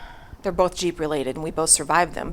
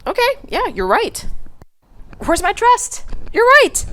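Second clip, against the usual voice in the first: much higher pitched, conceding "you're right"; sheepish giving in; 11 semitones higher than usual. Retold or imitated. Answering her husband's text, conceding in a high sheepish voice. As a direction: give in with a bashful, high voice. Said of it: "I was like".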